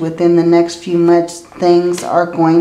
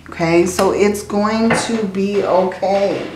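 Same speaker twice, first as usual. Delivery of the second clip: usual speaking voice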